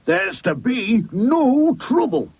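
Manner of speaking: slow, high, emphatic character voice; firm warning. Acted. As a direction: spell out the rule to naughty boys. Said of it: "said Mister Grimes firmly".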